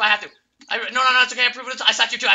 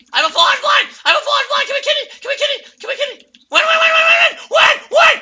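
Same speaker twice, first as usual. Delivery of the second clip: very high, shouted callouts; frantic hype screams; ecstatic shrieking; much faster than usual. Real, not acted. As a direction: scream it as victory nears. Final push to win.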